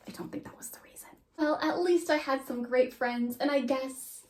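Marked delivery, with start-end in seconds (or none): 0.1-1.1 quick whispered aside; whispering. Real, not acted. Whispered aside during diary reading.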